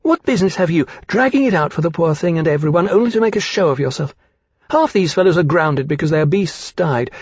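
put-on high-pitched girl rounding on someone; indignant rebuke. A narrator's reading. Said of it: "she rounded on him".

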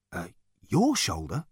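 ordinary delivery

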